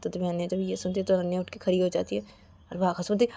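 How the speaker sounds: ordinary delivery, nothing marked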